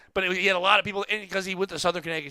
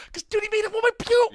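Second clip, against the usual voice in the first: excited; 4 dB louder than usual. Real, not acted. Raves about the set.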